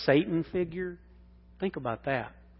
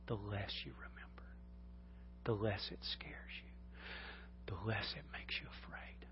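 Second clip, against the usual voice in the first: whispered, much softer, drawn in; hushed preaching; 3 semitones lower, 16 dB quieter than usual. Acted. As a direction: lean in and whisper it to them. Hushed sermon close.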